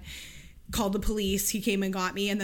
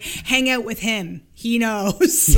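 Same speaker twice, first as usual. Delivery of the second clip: slow, louder, solemn approving parent; knowing parent voice; 8 dB louder, much slower than usual. Retold or imitated. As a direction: say it slowly like a believing parent. Voicing parents who'd approve.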